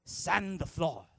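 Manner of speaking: slow, lightly voiced master's order; a hint of mock karate master; Mister Miyagi. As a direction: draw it out like a terse teacher.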